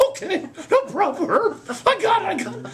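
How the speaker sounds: put-on very high squeaky boy voice; chirpy little boy reassuring his coach; high squeaky eager kid.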